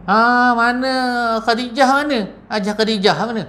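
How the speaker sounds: high, carrying call across the house. Acted. Calling someone by name.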